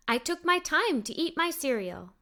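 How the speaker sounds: ordinary delivery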